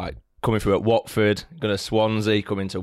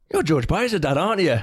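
higher, tentative question from a fan; hesitant fan; 8 semitones higher than usual. Retold or imitated. Fan approaching the player's dad in the street, hesitant and higher. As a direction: lightly voice a shy fan recognising him. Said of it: "they go".

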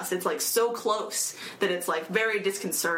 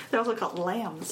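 ordinary talk

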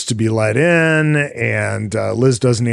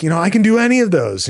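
higher, fast, breezy boast; cocky boast; 10 semitones higher, 3 dB louder, much faster than usual. Retold or imitated. Boyfriend boasting to girlfriend, fast and higher. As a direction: rattle it off high and cocky. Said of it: "he's like".

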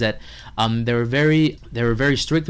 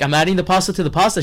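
fast, higher, flustered blurt; rushed nonsense answer; 6 semitones higher, 4 dB louder, faster than usual. Retold or imitated. Chef blurting to the host while panicking, fast and flustered. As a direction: blurt it out without thinking. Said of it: "I'm like".

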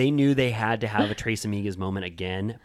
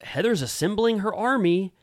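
mock Tamra; 8 semitones higher than usual. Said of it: "Tamra's like".

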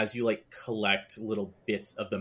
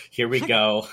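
louder, breaking into a laugh; laughing resignation; 9 dB louder than usual. Retold or imitated. Streamer recalling trying not to cry, laughingly resigned. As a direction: laugh through the retold exasperation.

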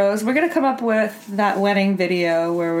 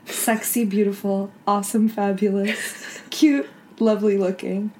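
soft, slow, softer mock-solemn vow; mock vow reading; 4 dB quieter, slower than usual. Shown as a demonstration. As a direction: read the clumsy message like a tender vow, quietly. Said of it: "read to you as a vow".

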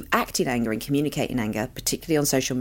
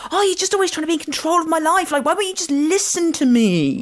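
put-on high, loud, whining teenage complaint; whiny teenager; 4 dB louder than usual. Teenager complaining to her mum, high loud whine. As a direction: wail it like a put-upon teen. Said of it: "And they're like".

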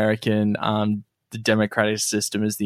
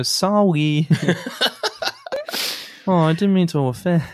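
put-on high, laughing baby-talk voice; 7 semitones higher than usual. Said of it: "What accent was that?".

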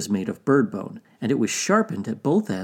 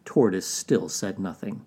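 ordinary delivery

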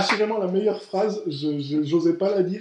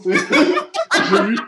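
laughing; 3 semitones higher, much slower than usual. Real, not acted. Still laughing after the joke.

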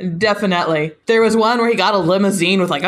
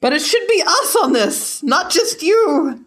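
pleading protest; 9 semitones higher than usual. Retold or imitated. Reader voicing a comic character's objection, high mock protest. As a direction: pitch it up into a theatrical objection.

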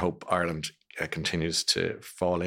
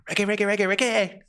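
high-pitched excited repeated name-chant; Chris Evans; 12 semitones higher than usual. Retold or imitated. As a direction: chant the name like a hyped-up presenter. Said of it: "Chris Evans starts going".

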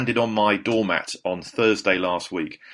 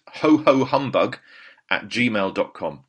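slow dictation; 3 dB louder, slower than usual. Shown as a demonstration. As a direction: spell it out slowly. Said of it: "repeating email".

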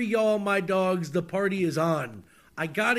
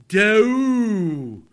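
drawn-out louder howl on the word; mock howl; 7 dB louder than usual. Host howls the lyric's last word. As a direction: howl it like a dog.

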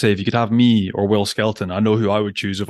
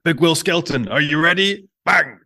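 much higher, hammed-up coach yelling at the lock; mock coach shout; 6 semitones higher, slower than usual. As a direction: play the fired-up coach, then the slap. Mimicking the pre-game ear slap.

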